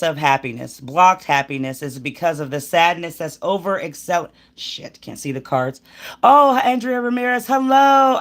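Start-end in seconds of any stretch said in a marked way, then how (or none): 6.2-8.2 excited greeting. Real, not acted. Greeting a live chat viewer.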